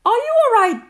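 high, loud worried calling; anxious call. A narrator's reading. Kanga checks on Roo in the water, anxious call. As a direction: call out anxiously to your child. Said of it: "called Kanga anxiously".

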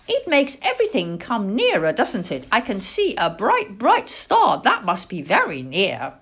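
put-on child-owl wonder, pitch leaping on key words; excited owlet. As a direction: marvel eagerly as a young owl. Plop marvels at the star.

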